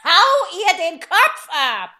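gebrüllt. Acted. Herzogin brüllt Befehl.

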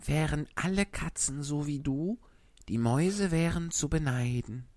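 soft and low in the ear; whispering a secret. Acted. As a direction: murmur it close and quiet. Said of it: "flüsterte ihm ins Ohr".

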